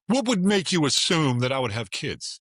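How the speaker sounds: high, slow affronted retort; indignant woman. Retold or imitated. Woman at a dinner table snaps back, slow and affronted. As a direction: play her as deeply offended by the question.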